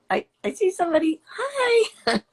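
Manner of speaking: squealing high, fast hello with a laugh; light up and squeal hello; excited greeting. Real, not acted. Spotting viewers joining, a delighted high squeal.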